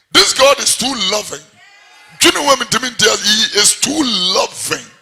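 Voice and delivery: loud, high declaration; emphatic preaching. Acted. Preacher proclaiming God's love to the church, loud.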